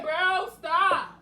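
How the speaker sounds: loud. Real, not acted. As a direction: shout them down. Breaking up the staged fight.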